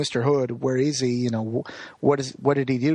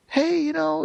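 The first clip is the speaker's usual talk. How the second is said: high-pitched imitated tearful greeting; mock crying; 13 semitones higher than usual. Wendell appears at his friend's house, mock crying. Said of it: "like, crying and is like".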